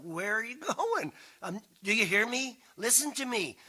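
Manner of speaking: put-on calling after the kids. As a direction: stop them at the door, voice up and loud.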